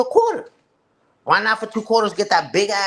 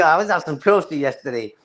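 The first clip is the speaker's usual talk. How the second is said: ordinary talk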